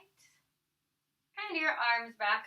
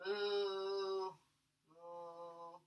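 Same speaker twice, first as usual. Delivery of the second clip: low, soft moos, the second much fainter; playful cow moo; 6 semitones lower, 10 dB quieter than usual. Pretend. Teacher cueing one last cow pose, low fading moos. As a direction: moo low and let it fade away. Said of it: "Y vaca".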